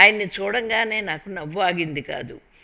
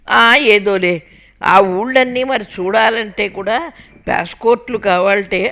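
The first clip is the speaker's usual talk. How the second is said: mother-in-law; 3 semitones higher, 9 dB louder than usual. A narrator's reading. Mother-in-law voiced, louder.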